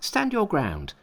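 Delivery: high, boastful monkey voice. A narrator's reading. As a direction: brag and dare him high.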